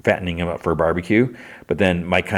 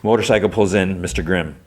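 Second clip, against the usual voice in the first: normal talking voice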